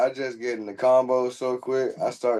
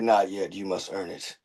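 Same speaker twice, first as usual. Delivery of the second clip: low stern master voice; 7 semitones lower, 7 dB quieter than usual. Retold or imitated. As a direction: deliver it like a wise master. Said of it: "I'll be like".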